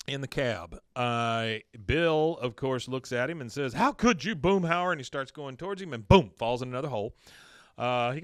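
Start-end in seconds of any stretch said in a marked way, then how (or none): 3.7-4.9 louder, higher mock outrage; acted wounded protest; hurt accusation. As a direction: cry out as if betrayed. Acting out Bill's hurt accusation.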